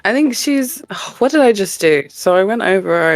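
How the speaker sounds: ordinary talk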